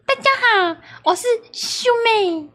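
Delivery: put-on high, sing-song girly voice. Shown as a demonstration. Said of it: "你脑中的gay掰声音是什么".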